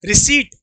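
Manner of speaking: रिसीट said for repeating, P silent; रिसीट ending in a plain T, no P; model pronunciation. Shown as a demonstration. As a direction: lead the class in रिसीट with long "ee". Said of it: "मेरे साथ बोलो रिसीट".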